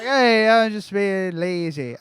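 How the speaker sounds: a light Irish lilt, slow and drawn out; mock Irish, stretching every word; hint of an Irish accent; Irish accent impression. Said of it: "I don't know how to say it. That was Irish".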